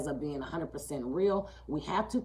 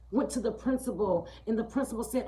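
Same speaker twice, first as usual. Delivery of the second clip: ordinary talk, nothing marked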